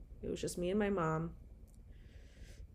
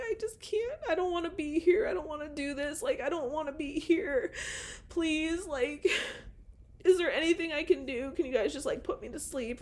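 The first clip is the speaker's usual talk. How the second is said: much higher, pleading, on the edge of tears; retold crying plea; 7 semitones higher than usual. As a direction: beg through tears to be put to sleep. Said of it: "and I'm just fucking crying".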